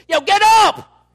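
sudden high, full-volume wake-up yell; booming, startling order from God; emphatic shout. Shown as a demonstration. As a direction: bellow the command, drawn out. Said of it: "I think he said it like this".

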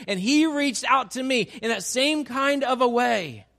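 fervent preacher pressing each example; preaching at full force. Acted. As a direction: drive the point home to the congregation.

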